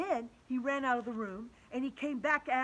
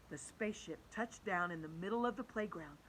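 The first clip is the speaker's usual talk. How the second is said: ordinary delivery, nothing marked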